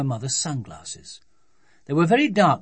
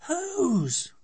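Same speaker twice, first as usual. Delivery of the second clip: put-on very high, choked child's question; strangled voice. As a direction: squeeze the word out in horror. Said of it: "in a strangled voice".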